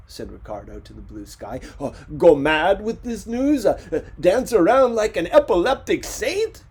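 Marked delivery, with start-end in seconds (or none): 1.8-6.6 loud, high, mocking despair; sarcastic rant. Ricardo ranting to the blue sky, sarcastic and loud.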